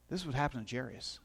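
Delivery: ordinary delivery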